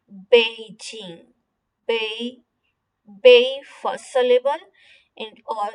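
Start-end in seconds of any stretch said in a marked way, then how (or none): 0.1-1.2 बीजिंग slowly, syllable by syllable; बीजिंग said slowly with a falling end; Beijing pronunciation. Shown as a demonstration. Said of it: "हम पढ़े हैं". (बीजिंग धीरे और साफ़ बोलना)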